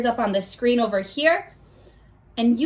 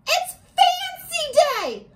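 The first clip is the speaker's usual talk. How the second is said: high, slow, drawn-out announcement; excited reveal; slower than usual. Real, not acted. Teacher thrilling her class with fancy day. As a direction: stretch it out like big news.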